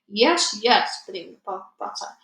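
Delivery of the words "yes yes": louder, blunt insistence; loud insisting giant. Acted. The Bloodbottler insisting to the BFG, loud blunt giant voice. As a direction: hammer the yes home.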